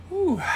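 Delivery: high breathy whew of relief; high relieved whew. Real, not acted. Relief after ice bath.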